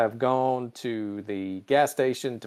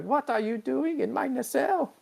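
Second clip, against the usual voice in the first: much higher, squeaky indignant Pelia; 13 semitones higher, 3 dB quieter than usual. Retold or imitated. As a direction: squeak it out like an offended old engineer. Pelia voiced in recap.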